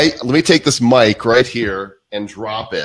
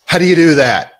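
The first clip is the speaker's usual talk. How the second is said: amazed; 6 semitones higher, 5 dB louder, faster than usual. Real, not acted. Amazed by memory feat.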